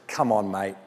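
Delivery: put-on Australian accent. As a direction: play the Lord as a laid-back Australian bloke. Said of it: "It's the Australian Bible".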